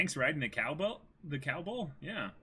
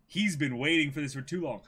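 ordinary delivery

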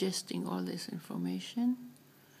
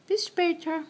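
parrot voice; 7 semitones higher, 3 dB louder than usual. Retold or imitated. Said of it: "She said that".

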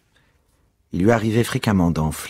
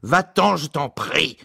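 higher mock yell to go away; vociferating; 10 semitones higher, slower than usual. A narrator's reading. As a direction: shout him off, hammed-up. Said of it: "vociféra Ignatius".

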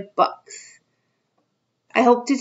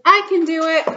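put-on higher, proud kid voice; little girl voice; 7 semitones higher than usual. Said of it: "She says".

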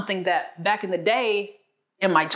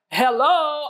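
higher "hello", shorter and lighter; replayed phone hello; 7 semitones higher, 3 dB louder than usual. Wife explaining her phone greeting to husband, raised replayed hello. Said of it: "ever talk to you like that".

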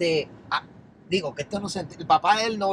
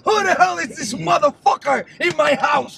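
loud, slow, higher-pitched yell; furious dad shouting; 7 semitones higher, 7 dB louder than usual. Retold or imitated. Friend imitates the girlfriend's father yelling at the intruder, slow furious shout. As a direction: bellow it like an outraged father catching a stranger at home.

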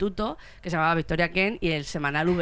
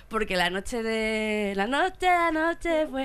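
dramatic slow build; 2 semitones higher, much slower than usual. Acted.

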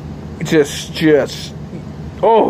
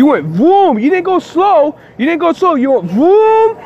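protesting to the driver who nearly hit him, agitated and high; upset protest; 6 semitones higher than usual. Real, not acted.

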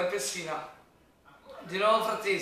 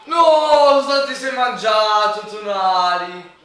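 much higher, loud despairing yell; furious shout; 5 semitones higher, 12 dB louder, much slower than usual. Real, not acted. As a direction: scream at the missed goal. Fan yells at missed chance.

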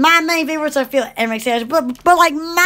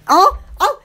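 ordinary delivery

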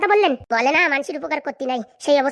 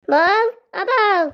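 long, drawn-out higher call; 5 semitones higher, much slower than usual. Acted. Husband calling for his wife, calling out. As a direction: stretch each call to reach her.

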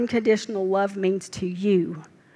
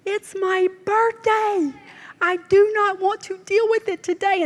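whiny complaint; 10 semitones higher than usual. Retold or imitated. Preacher mocking her own birthday grumbling, in a high whine. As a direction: put on a high petulant whine. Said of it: "I was like".

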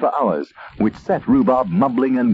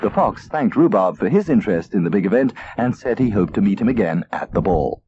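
plain reading voice

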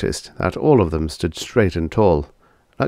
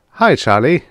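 called greeting; 6 semitones higher, 6 dB louder than usual. Acted. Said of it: "one of them called as he came in".

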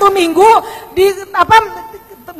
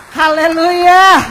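slow, long praise cry; drawn-out hallelujah; much slower than usual. Real, not acted. Preacher to the church, a slow drawn-out hallelujah. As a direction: stretch out a slow, ringing hallelujah.

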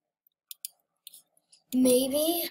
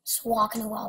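normal talking voice

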